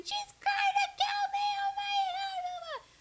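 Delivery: piercing high scream that fades out on the last words; terrified screaming. Retold or imitated. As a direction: shriek for help in a panic. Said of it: "and she is screaming".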